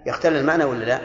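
ordinary talk

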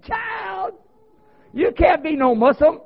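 high-pitched mock outcry; imitated elder lady. Elder lady disbelieving his conversion, imitated high outcry. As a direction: exclaim it as a scandalized elder lady. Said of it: "one of the elders there in the community".

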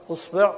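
"أصبع" as usbi', u then i; damma on hamza. Shown as a demonstration. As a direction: say "أصبع" with u first and i after the b. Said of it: "ضم الهمزة". (نطق "أصبع" بضم الهمزة وكسر الباء)

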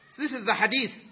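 normal talking voice

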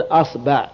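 أصبع as "asba'", a after hamza and ba; fatha on both. Shown as a demonstration. Teacher showing the class the nine forms, أصبع as "asba'". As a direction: say أصبع with two short a vowels, asba'. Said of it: "الفتح".

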